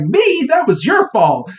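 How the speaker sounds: high, squeaky and offended pokemon voice; indignant Piplup. Retold or imitated. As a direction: squeal it back like a cartoon critter blaming its partner. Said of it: "And Piplup was like".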